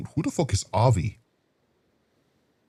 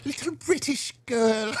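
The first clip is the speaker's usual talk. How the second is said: put-on high, very fancy little British girl; hammed-up British girl accent; terrible British impression; 13 semitones higher, 4 dB quieter than usual. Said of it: "My terrible British impression".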